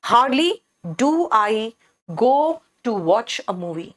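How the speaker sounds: teacher showing how fronting Hardly adds emphasis; stress on hardly. Shown as a demonstration. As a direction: punch Hardly, then draw the rest out slowly. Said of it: "मैं इस word पर जोर देना चाह रही हूँ".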